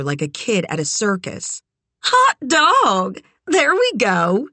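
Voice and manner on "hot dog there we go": high, loud, drawn-out cheer; delighted. A narrator's reading. Sugar celebrates Kit agreeing to come along, delighted. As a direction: whoop it like a kid at a circus.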